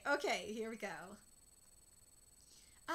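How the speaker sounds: ordinary delivery, nothing marked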